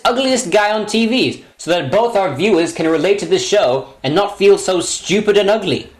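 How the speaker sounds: usual speaking voice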